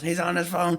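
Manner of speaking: high, mild mock yell; retold yell. Brother telling on him, high mock yell. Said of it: "Bryce yells".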